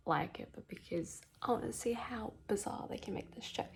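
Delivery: soft late-night whisper; hushed night whisper. Real, not acted. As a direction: keep your voice down. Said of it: "it is nine o'clock".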